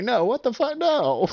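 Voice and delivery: high, loud protesting shout; imitated friend shouting in disbelief. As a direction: refuse to accept it, yelling. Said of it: "He was like".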